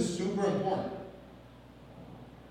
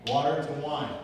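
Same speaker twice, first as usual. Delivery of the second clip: usual speaking voice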